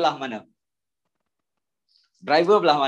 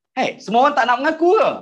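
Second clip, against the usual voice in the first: exasperated call; 8 semitones higher, 5 dB louder, faster than usual. Real, not acted. Teacher exasperated at silent class.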